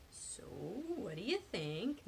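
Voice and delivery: very slow, drawn-out swooping question; leading question. A narrator's reading. Nick angles for his friend's opinion, drawn-out. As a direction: stretch it out, angling for an answer.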